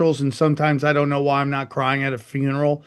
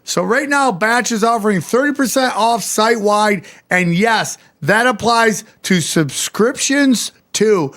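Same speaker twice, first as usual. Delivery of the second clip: higher, pushing the deal; excited ad read; 6 semitones higher than usual. Acted. Host urging listeners to grab the discount, excited pitch.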